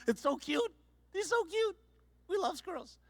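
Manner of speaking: very high, soft cooing over a cute squirrel; cutesy squeal. Retold or imitated. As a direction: coo like you just saw something adorable. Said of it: "we were like".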